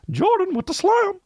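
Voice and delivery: squeaky falsetto, drawn out on slam; high-pitched voice. Retold or imitated. Kid imitating a sportscaster after a dunk, high falsetto. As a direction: mimic a kid's falsetto sports call. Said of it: "Justin McKinnon would go".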